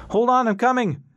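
high, loud shout to someone far off; calling out. A narrator's reading. Ras shouts to Callie that he is coming, loud call. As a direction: yell it across the gap to reassure her.

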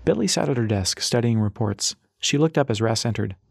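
plain reading voice

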